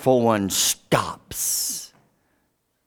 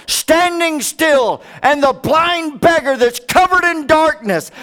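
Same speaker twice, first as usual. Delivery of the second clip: preaching at full force; 12 semitones higher, 4 dB louder than usual. Acted. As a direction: proclaim it to the whole church. Preaching climax.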